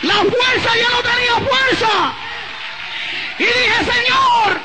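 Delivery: high, drawn-out Pentecostal shouting; sustained high revival holler, stretched phrase endings; full-force preaching. Real, not acted. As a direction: cry out to God and command the devil at the top of your range.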